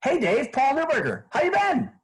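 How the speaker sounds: put-on high, bright friendly hello like an old pal; upbeat familiar greeting. Shown as a demonstration. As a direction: greet him like you already know him. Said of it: "And I say something like this".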